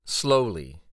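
ordinary delivery, nothing marked

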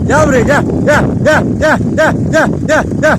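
sing-song call, coaxing dogs closer; high calling out. Real, not acted. Calling stray dogs over.

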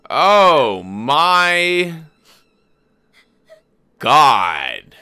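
loud, high "Oh my God" in disbelief; stunned shout at the screen; shocked exclamation. Real, not acted. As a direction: blurt it out in real shock. Shocked reaction to skeleton.

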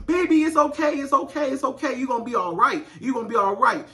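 put-on high, cooing baby comfort; soothing a crying toddler. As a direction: coo to a hurt little girl. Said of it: "The parents go".

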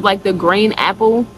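ordinary talk, nothing marked

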